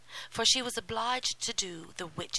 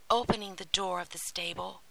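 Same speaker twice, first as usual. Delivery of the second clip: usual speaking voice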